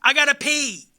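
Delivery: put-on high, loud kid voice; little boy. Little boy telling his mom at the doctor, high and loud. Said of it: "the little boy goes".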